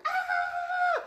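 loud, very high cry; acted shriek. Pig losing the straw house, high loud mock shriek. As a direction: squeal as the house flies off.

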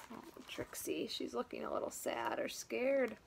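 sympathetic whisper; soft pity for her. Real, not acted. Feeling for sad Trixie with the class, whispering.

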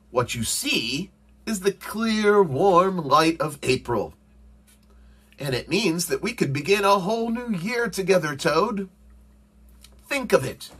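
high, excited storybook frog; eager coaxing. A narrator's reading. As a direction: paint spring to a reluctant friend, high and eager. Frog coaxes Toad out of bed.

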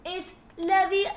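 high, loud British schoolgirl correction; Hermione. Acted. Streamer hamming up a film correction, high loud British voice. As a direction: push the O of Leviosa up high. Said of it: "Hermione quotes".

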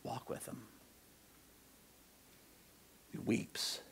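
very soft, with long pauses; hushed. Real, not acted. Preacher urging people to see Jesus weep, hushed. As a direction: let it drop almost to a whisper.

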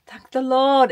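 ordinary delivery

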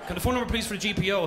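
fast, rushed caller; rapid-fire caller request; hurried caller. Retold or imitated. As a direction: blurt it out quickly. Caller asking quickly.

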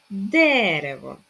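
"Дерево" loud, with stretched де; "Дерево", slow, leaning hard on the first е; stress on first е. Shown as a demonstration. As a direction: punch the first syllable of "Дерево". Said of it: "Наголос на першу е".

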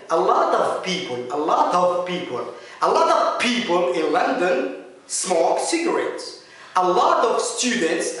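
slow, "of" said with a light "v", "a lot of". Shown as a demonstration. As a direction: let the f voice into a v. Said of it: "هذه a lot of بالفي شوية". ("of" بصوت v خفيف)

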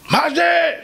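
high-pitched indignant yelp; outraged neighbour. Retold or imitated. Angry neighbour bursts in again. (צווחת שכן זועם)

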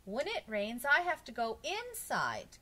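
put-on a child's mild grumble, voice lifted; complaining. A narrator's reading. Myron complaining he must go inside when it rains, grumbling. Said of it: "complained Myron".

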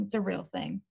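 ordinary delivery

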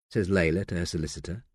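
plain reading voice

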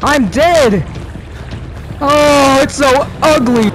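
high, loud frantic shouts; shouting. Real, not acted. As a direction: yell it out in shock. Caught by monster.

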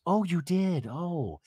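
lightly acted concern, dropping to a soft "oh". Jen hearing that Katie left, mock concerned. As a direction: imitate a friend taking in sad news. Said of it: "This is my concerned voice".

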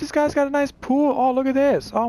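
high thrilled squeal; excited. Real, not acted. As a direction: gush over the pool, up high. Excited at the pool.

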